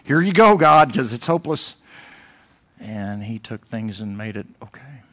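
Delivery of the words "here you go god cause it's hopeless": retold hopeless cry, louder and higher; a burst of remembered despair; mock despair. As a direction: throw up your hands and tell God it's hopeless. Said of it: "I thought".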